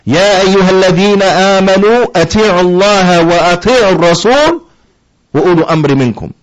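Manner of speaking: rapid melodic Quranic chanting in Arabic; Quran recitation. Shown as a demonstration. Teacher recites the verse to students in melodic chant.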